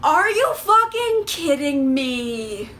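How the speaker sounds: slow, drawn-out incredulous reaction; exasperated disbelief. Retold or imitated. Reviewer reacting to the dumb plot twist, slow exasperated disbelief. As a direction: stretch it out in disbelief. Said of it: "And I'm just like".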